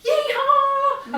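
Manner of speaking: high, loud whooping yeehaw; yell. Shown as a demonstration. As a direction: whoop it out at the top of your voice. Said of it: "you yell at the top of your voice".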